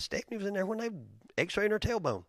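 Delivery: usual speaking voice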